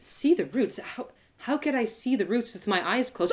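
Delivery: ordinary delivery, nothing marked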